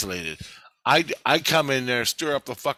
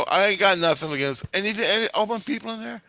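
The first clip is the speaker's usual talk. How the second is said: defiant retort; 10 semitones higher than usual. Retold or imitated. Retelling his own defiant reply.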